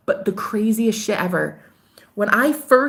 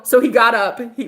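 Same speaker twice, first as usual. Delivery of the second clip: ordinary delivery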